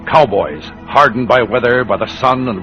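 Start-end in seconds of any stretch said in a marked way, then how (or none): none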